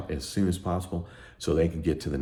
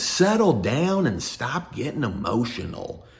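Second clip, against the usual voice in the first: high, stern lecture to whining clients; scolding a client; 11 semitones higher than usual. Retold or imitated. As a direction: talk down to a whiny client. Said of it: "chapter telling clients".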